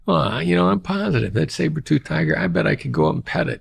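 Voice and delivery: high, mocking voice of a clueless optimist; naive optimist. Mocking the optimist who walks up to a saber-toothed tiger, high and goofy. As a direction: play the dopey optimist who pets the tiger.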